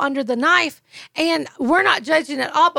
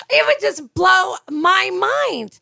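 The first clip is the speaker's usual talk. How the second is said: amazed; 6 semitones higher, slower than usual. Real, not acted. Exasperated laughing retelling.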